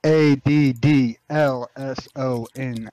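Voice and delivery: each letter loud and drawn out; slow, loud spelling. Real, not acted. Gamer respelling a name for someone who missed it, slow and loud. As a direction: spell it out slowly, letter by letter, loudly. Repeats spelling after not being understood.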